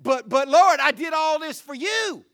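loud, high desperate plea; pleading at the gate. Acted. Rejected believers begging the Lord, loud and pleading. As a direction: beg the Lord not to shut you out.

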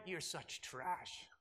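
quiet, menacing mock warning; mock contempt. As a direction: parody a condescending judge. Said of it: "He also doesn't go and say".

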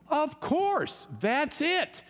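high, eager burst of discovery; excited realization. Retold or imitated. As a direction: pitch it way up like a light just went on. Group's realization in vision story.

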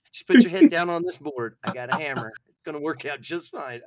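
playful loud threat, easing off toward the end; mock menacing dad. Dad offering his son a hammer cure, mock menacing. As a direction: play a dad cheerfully offering a drastic fix.

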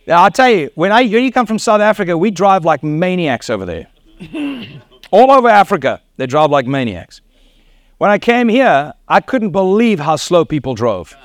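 normal talking voice

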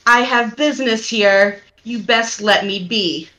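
usual speaking voice

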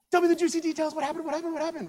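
high, loud, eager begging for the story; an excited mother-in-law bursting to hear the news; eager gossip. Acted. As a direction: pester her for every juicy detail.

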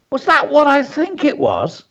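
tense, high-pitched snapping question; high, urgent retort; snapped retort. A narrator's reading. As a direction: snap it back in startled disbelief. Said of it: "he snapped back".

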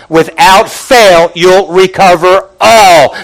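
slow, emphatic build to a long "all"; stress on all. Shown as a demonstration. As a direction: draw out "fail", then hammer "all". Repeats corrected promise with emphasis.

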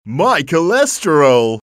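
loud, slow, high wail. Acted. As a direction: cry out in dismay. Actor breaks into a cry.

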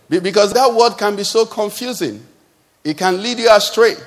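normal talking voice

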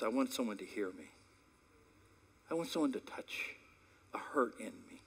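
a hushed, aching request; soft plea. Acted. Daughter's hidden need, voiced soft and tender.

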